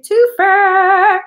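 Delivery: "twofer" blurted out high and loud; excited shout. Real, not acted. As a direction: throw "twofer" out with a burst of excitement. Repeats "twofer" emphatically.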